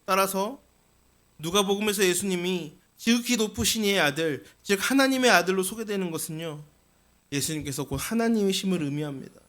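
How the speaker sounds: ordinary delivery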